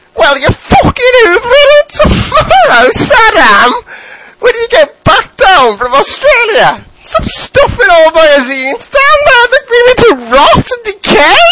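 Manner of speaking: high, loud shrieking mum; nagging mother yelling. Acted. Mother yelling at Saddam to hoover, high loud shriek. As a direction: bellow it like a furious mum. Mother yells about chores.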